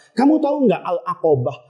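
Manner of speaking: normal talking voice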